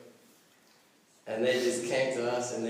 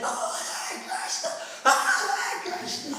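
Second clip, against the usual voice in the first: high, strained ecstatic cry; hammed-up devotional outcry; imitated ecstasy; 3 dB louder than usual. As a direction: imitate a chanter carried away in ecstasy. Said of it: "then he went into ecstasy".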